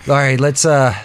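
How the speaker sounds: normal talking voice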